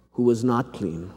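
ordinary delivery

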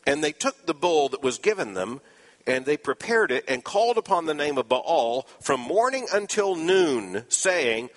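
ordinary delivery

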